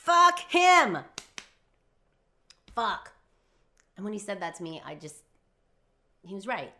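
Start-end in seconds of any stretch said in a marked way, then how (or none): 0.0-3.1 high, full-voiced furious shout; loud, frustrated yell; angry outburst. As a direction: explode on each swear word. Sudden outburst within the retold quarrel.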